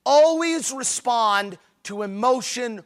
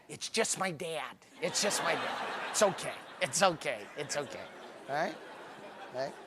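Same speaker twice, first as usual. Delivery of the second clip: much softer, lower reassuring mutter; hushed aside; 7 semitones lower, 12 dB quieter than usual. Retold or imitated. Kids quietly telling strangers their dad is crying, hushed. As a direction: quietly wave it off.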